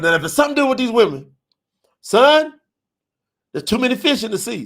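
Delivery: hammed-up dad reassuring his son; daddy's voice.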